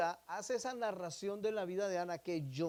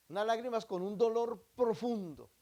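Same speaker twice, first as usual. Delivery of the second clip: normal talking voice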